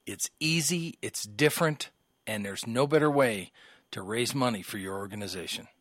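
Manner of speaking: upbeat announcer pitch; radio ad read. Acted. Host reading the comedy club ad aloud, upbeat pitch. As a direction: sell the fundraiser to listeners.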